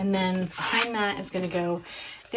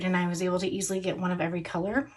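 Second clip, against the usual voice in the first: normal talking voice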